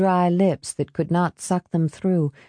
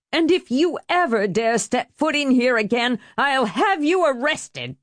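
higher-pitched, outraged threat; angry threat; 7 semitones higher than usual. A narrator's reading. Helen ordering Marie out of her house, outraged. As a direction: threaten them, pitched well up.

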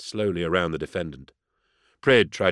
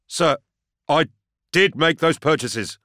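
high, loud, slow confession; raised, strained-high defendant's voice; louder for the court; 9 semitones higher, 5 dB louder than usual. A narrator's reading. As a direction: force the admission out for all to hear. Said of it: "Louder, for the court".

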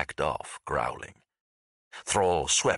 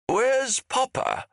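high, drawn-out call over the noise; 4 dB louder, slower than usual. A narrator's reading. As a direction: shout to be heard over an argument. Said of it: "he called out, fighting to be heard over the argument".

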